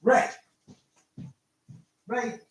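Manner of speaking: sharp call to a wandering dog. Real, not acted. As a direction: shout the dog's name to fetch him. Calling the dog across room.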